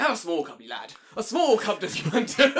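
loud, hammed-up British old man; British accent. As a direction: put on a jolly British accent, breaking into laughter. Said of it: "he's gonna be British".

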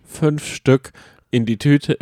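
ordinary delivery

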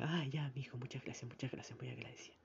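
put-on soft, fading grateful old lady; trailing off into a breathy thank-you. Old lady thanks him for the map, soft and fading. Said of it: "Y ella".

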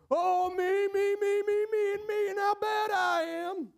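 high, wailing mock self-pity; hammed-up lament. Self-absorbed sinner bemoaning himself, hammed-up lament. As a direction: wail it high in one run, wallowing.

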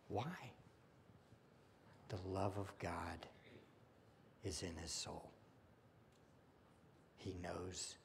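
soft, near-whispered reverent words; hushed preaching. Real, not acted. Preacher softly telling the congregation of God's love. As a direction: drop to a hush as if sharing a secret.

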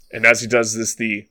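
ordinary delivery

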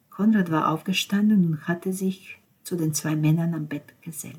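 ordinary delivery

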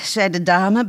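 plain reading voice

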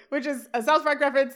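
usual speaking voice

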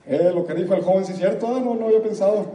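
loud mock agreeing inner voice; inner squirrel voice. Speaker voices the audience's squirrel agreeing, loud and mocking. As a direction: play the squirrel nodding along. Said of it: "la ardilla de la gente habla así".

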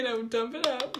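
normal talking voice

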